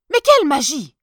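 thrilled emperor asking; excited emperor. Acted. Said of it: "tout excité".

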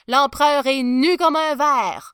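high, loud exclamation; loud proclamation. A narrator's reading. Mother announcing the emperor was fooled, exclaiming. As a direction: declare the scandal for all to hear.

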